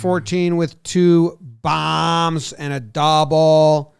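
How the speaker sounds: drawn-out, loud stadium announcer; slow announcer call. Acted. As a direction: stretch each word like a PA call. Announcing player stats.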